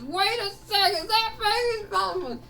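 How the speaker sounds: very high, loud, hurried disbelief; shocked yell. Acted. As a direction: squeal it in alarm, voice way up.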